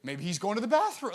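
high mocking taunt, lightly played; sarcastic. Elijah mocking Baal's prophets, playful sarcastic taunt. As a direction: tease them like a smug sibling. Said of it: "he's really being sarcastic".

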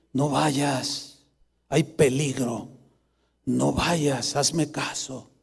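low, soft voice of the Spirit; hushed warning. Retold or imitated. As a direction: warn gently and slowly, almost under your breath. Retold warning from Holy Spirit. (advertencia en voz baja y suave)

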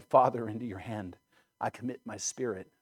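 ordinary talk, nothing marked

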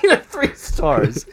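very high, cartoonish squeak. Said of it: "sounds like a Simpsons character".

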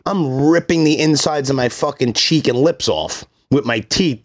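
normal talking voice

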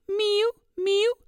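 high mewing like a cat. Shown as a demonstration. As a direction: mew high like a cat bird. Narrator imitates cat bird.

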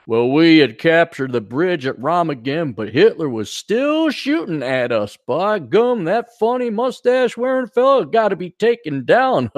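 folksy mock Texas monologue; Texas dope. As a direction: narrate like a corny Texan war hero. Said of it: "the good old American Texas dope".

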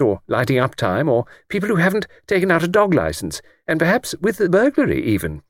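put-on high, eager elderly lady voice; chatty old lady. Old lady on the train chatting to Luke, high elderly voice. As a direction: play a talkative old lady delighted by a stranger.

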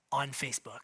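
dropped low and soft, a sly punchline; deadpan aside. Acted. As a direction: slip the joke in quietly under your breath.

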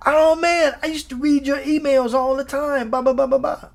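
high, gushing fan voice; excited fan. Acted. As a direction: play a listener thrilled to meet the writer. Acting out an excited fan greeting him.

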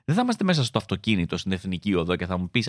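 ordinary talk, nothing marked

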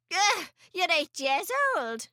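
scornful grandmother, pitch jumping up; exasperated storybook scoff; snorted scorn. A narrator's reading. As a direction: snort in mild disgust at the boy. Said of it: "she snorted".

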